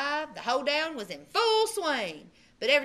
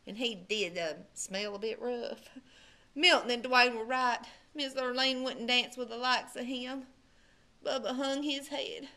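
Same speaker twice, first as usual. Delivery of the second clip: ordinary delivery, nothing marked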